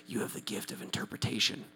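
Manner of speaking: hushed, breath-only wonder; awestruck whisper. Retold or imitated. Korean man to the visitor who understood him, awestruck whisper. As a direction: whisper it like a huge revelation. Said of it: "His eyes get big and he says".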